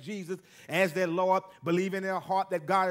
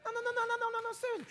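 mock refusal; 5 dB quieter, much faster than usual. Mock refusal of church giving.

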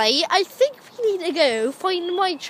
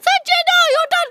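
mock scolding; 11 semitones higher, 5 dB louder, faster than usual. Scolding the pretend dog.

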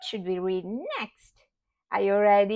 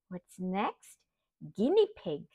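ordinary delivery

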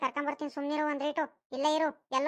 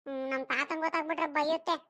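ordinary talk

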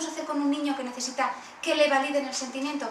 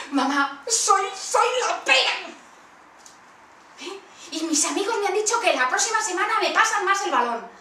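put-on much higher, louder, boasting child; proud kid; 6 semitones higher, 5 dB louder than usual. Son tells mum he's the best, boasting high and loud. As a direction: crow about it like a proud boy.

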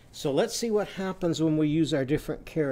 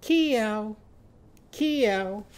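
high meows, each sliding down; cat meow; 7 semitones higher than usual. Shown as a demonstration. Teacher answering as Kimmy Kangaroo's cat, high falling meows. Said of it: "What does Kimmy Kangaroo's cat say?".

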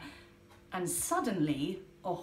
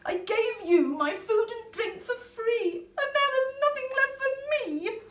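high, loud tearful complaint; tearful Polly; 13 semitones higher, 7 dB louder than usual. Acted. Polly to greedy animals, high tearful wail. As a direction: wail it out like a hurt child. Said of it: "Polly cried".

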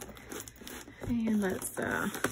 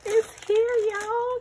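much higher, thrilled squeal; excited; 10 semitones higher, slower than usual. Real, not acted. Telling viewers the keyboard arrived, excited high squeal. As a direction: burst out with joy. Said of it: "Can you hear the excitement in my voice?".

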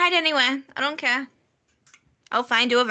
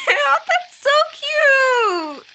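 squealing, much higher and louder; 10 semitones higher, 5 dB louder, much slower than usual. Real, not acted. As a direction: squeal it with delight. Squealing over cute avatar.

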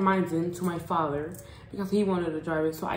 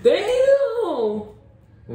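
1 surprised exclaim; 11 semitones higher, 3 dB louder than usual. Real, not acted. Reacting to six on two-seater.

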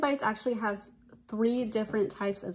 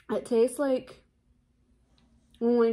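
muffled behind fingers; speaking through covered lips; hand over mouth; 3 semitones higher, 6 dB louder than usual. Real, not acted. Seen 2: red-shirt speaker covers mouth from 1.4s; chewing not visible.

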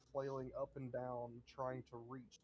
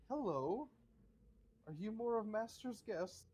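polite butler; 9 semitones higher, 4 dB louder than usual. Acted. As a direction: welcome them like a proper servant. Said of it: "and looks down and says".

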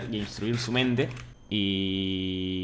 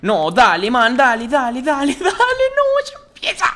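much higher, much louder desperate urging; frantic high yelling; 13 semitones higher, 11 dB louder, slower than usual. Real, not acted. Streamer shrieks at the game to start the invasion.

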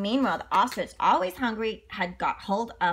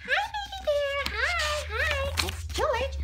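high friendly George; slower than usual. Acted. George greeting the baby bear, high voice. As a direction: coax the bear gently.

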